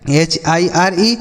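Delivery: usual speaking voice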